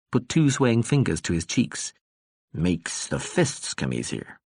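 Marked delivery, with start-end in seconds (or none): 2.5-4.4 put-on slurred, rambling drunk; lightly voiced tipsy ambassador; drunk man voice. Drunk Tsar mimes boxing.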